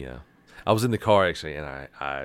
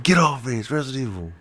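excited protest; 7 semitones higher, 3 dB louder than usual. Retold or imitated. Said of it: "I was like".

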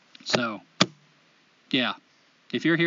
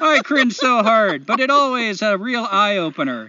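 put-on Canadian accent; 3 semitones higher, 5 dB louder than usual. Host reading a listener's letter aloud, hammed-up Canadian accent. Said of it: "in the best Canadian accent I know".

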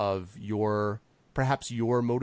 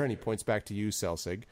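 ordinary talk

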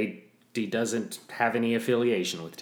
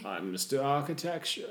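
higher, softer put-on snob voice; mock fancy gentleman; 5 semitones higher, 4 dB quieter than usual. Mister Fancy correcting his nickname, mock refined. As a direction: introduce yourself with affected refinement. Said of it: "Mister Fancy".

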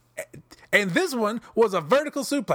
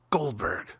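lower, softer "Goldberg" in dismay; deflated disbelief; 11 semitones lower, 5 dB quieter than usual. Real, not acted. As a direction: let the name sink in defeat. Disbelief at title result.

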